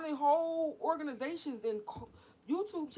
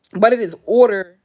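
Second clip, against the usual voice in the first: bursts out in a real loud yell; sudden shout; 3 semitones lower, 22 dB louder than usual. Real, not acted. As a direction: shout the word order out. Sudden shout mid rant.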